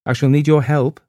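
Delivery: ordinary delivery, nothing marked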